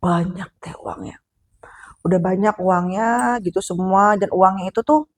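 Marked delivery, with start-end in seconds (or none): 0.0-1.2 whispered, softer, slow; awed whisper. Real, not acted. Storyteller whispers to the listener about the piles of money. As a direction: whisper it like a secret. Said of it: "telling of sudden wealth".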